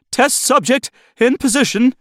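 high-pitched lab assistant reporting; squeaky technician report. Acted. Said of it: "the technician reported".

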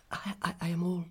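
high, whispery, stammering nervousness; timid, fluttery young mother; faltering. A narrator's reading. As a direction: stumble over the first word, trembling. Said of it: "faltered my mother".

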